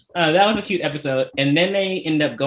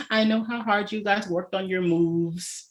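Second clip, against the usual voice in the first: slow, high, softer tearful mom voice; slowly, as a moved mother; 6 semitones higher, 4 dB quieter than usual. Retold or imitated. Mom to trainees who performed Shoot, tearful and slow. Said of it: "She's like".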